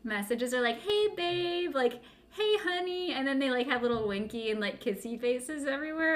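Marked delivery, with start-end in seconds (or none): 0.8-1.7 high, sweet mock greeting; cutesy high voice. Quoting flirty text messages. 2.3-3.1 high, sweet mock greeting; cutesy high voice. Quoting flirty text messages.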